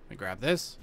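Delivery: ordinary talk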